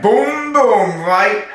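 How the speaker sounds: high, sharp crash noise: boom; mock crash boom. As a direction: shout out a car crash boom. Car crash sound retold.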